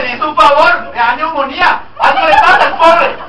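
loud, rising mock outrage; mock protest. An imagined listener defending Noah, in loud mock outrage. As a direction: protest loudly on the poor man's behalf.

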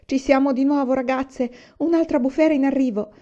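urgent alert to the household; urgent warning. A narrator's reading. Mother runs in warning of blizzard.

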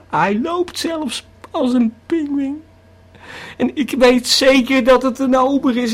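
hoger en harder, opgejaagd vertellend; opgewonden paniek. Acted. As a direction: praat alsof de monsters je insluiten. Paniekerige hallucinatie over monsters. (opgewonden, hoger en harder vertellen)

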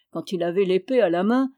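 ordinary delivery

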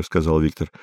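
ordinary delivery, nothing marked

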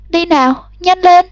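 high, pressing hurry-up; urgent. A narrator's reading. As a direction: tug her along, frantic. Girl pulling mother's arm. (hối thúc mẹ)

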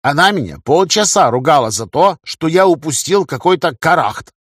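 aggrieved comrade's moan; complaining. A narrator's reading. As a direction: complain like a scolded sidekick. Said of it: "пожаловался Игорь".

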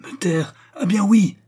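defiant outburst. A narrator's reading. Condemned woman defies order to be silent. (riposte de défi)

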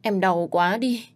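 sister-in-law pleading pitifully to the family; broken voice. Pretend. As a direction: stammer out the blame with hammed-up tears. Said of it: "Giọng nói ngắt quãng".

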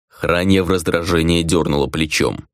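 ordinary delivery

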